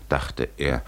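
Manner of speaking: usual speaking voice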